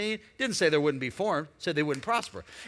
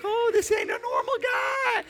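high falsetto mock warning; squeaky voice warning of a crazy guy; 4 dB louder than usual. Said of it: "You're like".

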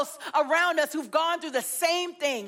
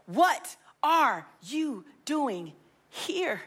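slow searching question; 2 semitones lower, much slower than usual. Acted. God's question to us, drawn out.

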